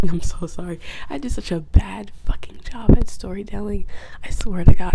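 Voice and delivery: ordinary talk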